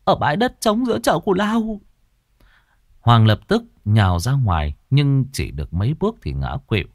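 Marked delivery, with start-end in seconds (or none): 0.0-1.8 high, put-on tearful voice; fake whimpering. A narrator's reading. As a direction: pretend to cry while answering. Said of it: "mếu máo bằng vẻ mặt giả tạo".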